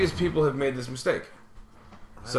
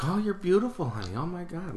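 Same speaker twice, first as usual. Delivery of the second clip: higher, sugary mock-sweet grandma praise; condescending grandma; 4 semitones higher, 5 dB quieter than usual. Imitating grandma condescending to his girlfriend. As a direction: talk down to her like a sweet old lady. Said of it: "in a way that was condescending to me".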